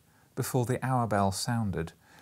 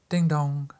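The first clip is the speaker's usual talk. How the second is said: bell chime; 5 semitones higher, 6 dB louder than usual. Pretend. Clock chimes the quarter.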